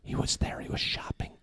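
hushed, breathless whisper about the celebrity; conspiratorial whisper. Retold or imitated. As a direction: lean in and whisper it like a secret.

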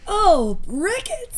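high, bright "aha" of discovery; surprised realization. Retold or imitated. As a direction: act like you just cracked the case. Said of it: "And they're like".

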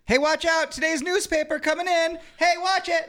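high warning yell; high shout. Acted. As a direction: yell it at a passerby.

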